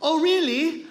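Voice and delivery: high, loud mock thanks; mock gratitude to doctor. Patient asks the doctor what is owed, in mock gratitude. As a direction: play the patient high and overdone.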